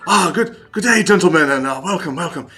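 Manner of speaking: put-on amateur British accent, voice lifted; attempted British accent. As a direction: attempt a British officer greeting guests. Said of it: "he's speaking in a British accent".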